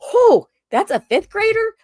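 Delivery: high, loud disbelieving gasp of a question; shocked question. Retold or imitated. Told shocking slang, a high loud shocked question. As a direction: sound stunned and loud. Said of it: "I was like".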